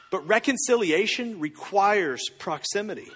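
normal talking voice

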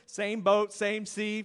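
ordinary talk

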